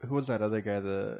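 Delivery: ordinary talk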